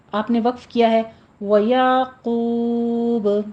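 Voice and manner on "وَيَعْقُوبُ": slow وَيَعْقُوبُ with a smooth عین, stopping on it; pause on وَيَعْقُوبُ. Shown as a demonstration. Teacher models the stop on وَيَعْقُوبُ. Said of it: "عین ساکن کے اوپر قلقلہ نہیں کرنا".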